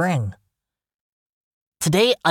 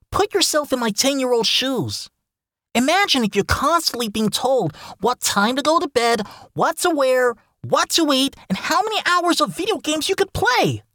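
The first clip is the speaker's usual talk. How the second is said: whiny complaining; 7 semitones higher than usual. Acted. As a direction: pitch climbing higher with indignation. Kid complaining about strict parents.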